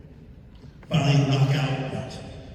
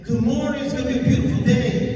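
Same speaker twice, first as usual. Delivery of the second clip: high sing-song mock greeting, quick; cheery host mother; 8 semitones higher, faster than usual. Comic contrasts the host lady's high cheerful morning greeting. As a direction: chirp it like a cheery morning lady. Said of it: "This lady says".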